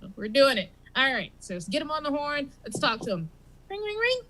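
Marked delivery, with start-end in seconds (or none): 3.7-4.2 phone ring. Pretend. Mimicking a phone ringing.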